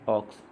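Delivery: ऑक्स with the O said like औ; open O sound. Shown as a demonstration. Teacher showing learners how O sounds, open vowel in ऑक्स. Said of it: "ओ का उच्चारण औ की तरह".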